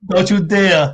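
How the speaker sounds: a New York warning; mock Yonkers accent. Imitating his wife's New York accent on "dare". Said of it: "You hear it?".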